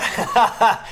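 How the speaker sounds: loud, high laugh; burst of laughter. Real, not acted. Guest cracks up at interviewer's beer funnel question, loud laugh.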